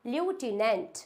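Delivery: "लेफ्टिनेंट" as lyoo-ti-nent; wrong pronunciation. Shown as a demonstration. Teacher shows a common mistake, "लेफ्टिनेंट" as lyoo-ti-nent. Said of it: "ये लेफ्टिनेंट नहीं होता है".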